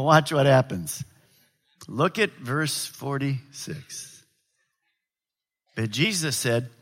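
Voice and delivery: ordinary talk, nothing marked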